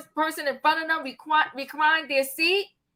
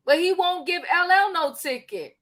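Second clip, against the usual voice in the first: usual speaking voice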